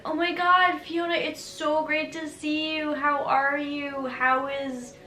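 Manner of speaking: high, excited fake-friendly greeting; gushing acquaintance. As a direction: gush like an overeager acquaintance. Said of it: "when somebody's like".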